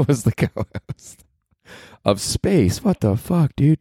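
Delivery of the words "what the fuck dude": mock outrage. Mock outrage at former co-host.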